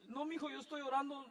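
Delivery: softly reassuring his son through tears; a touch of crying; tearful father. Retold or imitated. Pastor retells praying tearfully beside his son. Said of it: "Le dije".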